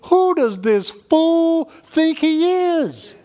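high, drawn-out mock indignation; incredulous vernacular. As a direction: scoff in modern street talk, stretched out and high. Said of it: "change that into modern day vernacular".

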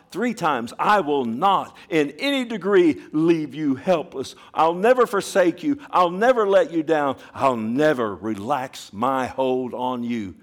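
loud, high fervent preaching; preaching at full force. Acted. Preacher assuring the church God never fails, loud and fervent. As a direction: thunder the promise to the congregation.

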